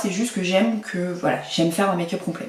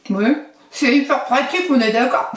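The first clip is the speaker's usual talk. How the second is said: higher voice through pinched lips; jokey, higher-pitched pinched-mouth speech; 5 semitones higher, 4 dB louder than usual. Shown as a demonstration. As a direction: talk while pursing your lips tight. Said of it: "vous pincez vos lèvres".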